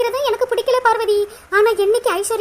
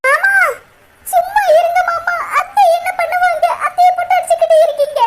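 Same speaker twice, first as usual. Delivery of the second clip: higher, louder pleading cry; urgent raised protest; pleading shout; 7 semitones higher, 5 dB louder than usual. Acted. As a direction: shout to stop him hitting her. Pleading uncle to stop beating.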